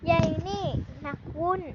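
ordinary talk

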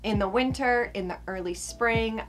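ordinary delivery

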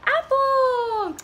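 high, drawn-out surprise; singsong page-turn payoff; food reveal. A narrator's reading. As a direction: announce it with delight.

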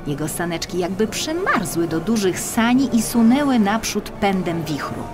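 ordinary delivery, nothing marked

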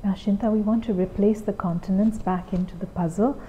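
put-on soft, patient classroom voice to a small child; gentle teacher voice. Shown as a demonstration. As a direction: guide the child calmly through the lesson. Teacher's demo lesson with child.

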